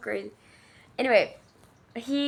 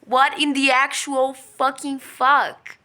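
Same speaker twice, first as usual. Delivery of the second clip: disgusted outburst; 3 semitones higher, 6 dB louder than usual. Real, not acted. Disgusted reaction to the story.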